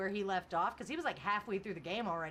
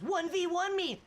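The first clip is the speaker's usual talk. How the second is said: mock challenge; 8 semitones higher, 5 dB louder than usual. Reading chat challenge aloud.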